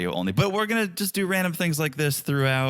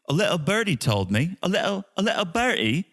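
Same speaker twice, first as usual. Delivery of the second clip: put-on higher-pitched British accent; 7 semitones higher than usual. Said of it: "I hate that you sound pretty good".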